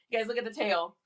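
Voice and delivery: ordinary delivery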